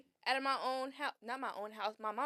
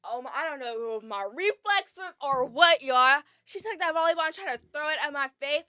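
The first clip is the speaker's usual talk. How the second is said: ordinary talk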